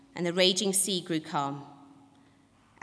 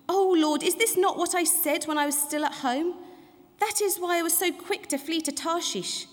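angry prayer; 10 semitones higher than usual. A narrator's reading. Displeased Jonah praying to the Lord, angry. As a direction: read it as a raised, aggrieved complaint. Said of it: "Jonah was greatly displeased and became angry".

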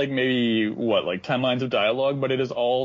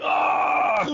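mock scream; 8 semitones higher than usual. Being hit by truck.

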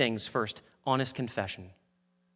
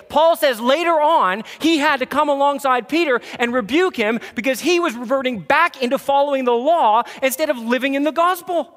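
higher, louder, fired-up sermon delivery; preaching at full force; 11 semitones higher, 8 dB louder than usual. Acted. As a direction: preach it hard and rising to the crowd.

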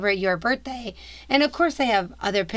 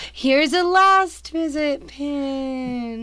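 Disney cast member voice; 3 semitones higher than usual. Retold or imitated. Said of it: "do you think it's like".

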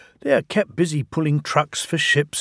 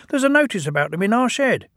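high, fast little engine; twin engine voice; 9 semitones higher, much faster than usual. Acted. Ben telling Bill about the notice, high and quick. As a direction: chatter it quickly like a small tank engine.

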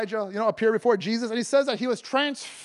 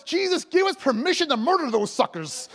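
higher, hammed-up plea; mock-eager disciples; 6 semitones higher than usual. Preacher paraphrases disciples asking Jesus for revenge, hammed-up eagerness. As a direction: beg for permission with comic zeal. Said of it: "In other words".

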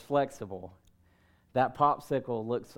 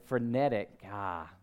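ordinary delivery

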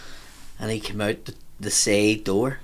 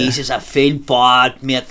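put-on slow, louder drunken lament; getting louder; 5 dB louder, much slower than usual. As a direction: drag it out, louder, imitating a remorseful drunk. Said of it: "he was getting louder".

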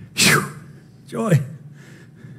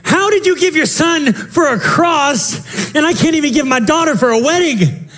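preaching shout; 9 semitones higher than usual. Real, not acted. Preacher's climax to congregation.

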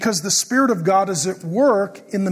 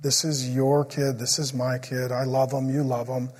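softer, lower, a gentle prayer; retold soft prayer; 5 semitones lower, 5 dB quieter than usual. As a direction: pray gently over the child.

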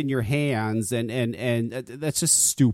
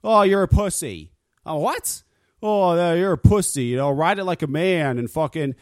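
mocking coworkers; 6 semitones higher than usual. Coworkers taunting him over handlebar risers, mocking jeer. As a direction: taunt him like a macho biker.

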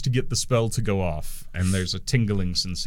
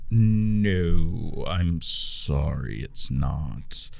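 much lower, slow gnome voice; uncomfortably deep voice; 5 semitones lower, much slower than usual. Acted. Gnome denies the sheep is theirs, deep and slow. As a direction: drop the voice low and slow. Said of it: "the little gnome with the uncomfortably deep voice".

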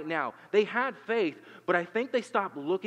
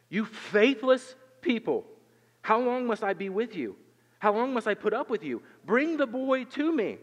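exasperated rebuke; 6 semitones higher, 4 dB louder than usual. Acted. Jesus rebuking his faithless disciples, loud and exasperated. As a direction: let the frustration ring out over the crowd.

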